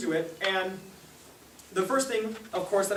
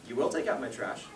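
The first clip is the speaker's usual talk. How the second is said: low, quiet parental command; firm order in a lowered, softened voice; stern mother; 7 semitones lower, 6 dB quieter than usual. Retold or imitated. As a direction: imitate a mom laying down the law softly. Acting his mother giving an order.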